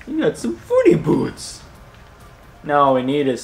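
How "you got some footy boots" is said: very high, playful falsetto; goofy high-pitched teasing; silly voice. Pretend. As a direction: squeak it out as a silly little taunt. Playfully repeats the silly nickname.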